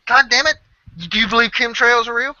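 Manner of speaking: very high, loud disbelief; hammed-up outburst. Ed demanding if he believes chemtrails, very high and loud. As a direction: squeal it in outraged disbelief. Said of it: "almost an argument like that".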